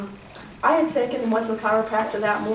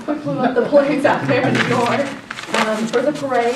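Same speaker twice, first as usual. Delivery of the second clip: louder, higher, pressing the point; louder retelling; 4 semitones higher, 8 dB louder than usual. Shown as a demonstration. Retelling the balloon story again, louder and emphatic. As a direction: say it again with more punch. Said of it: "You'll love this".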